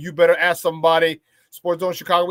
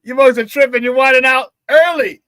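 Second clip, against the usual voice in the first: higher, louder hype; excited; 6 semitones higher, 8 dB louder than usual. Real, not acted. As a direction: pump up the crowd at full volume. Reacting to the lively chat.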